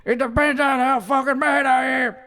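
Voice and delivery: loud, high shouted line; shouting. Acted. Streamer shouts about his moods, loud. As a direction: yell it out, mock mad.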